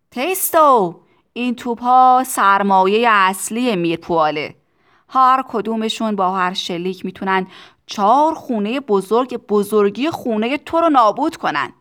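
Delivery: big booming boast over the din; loud shout. A narrator's reading. Factory owner boasting to Tistu about his cannons, shouting. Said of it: "آقای ترونادیس فریاد زد".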